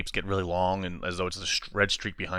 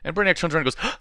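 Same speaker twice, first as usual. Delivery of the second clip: ordinary talk, nothing marked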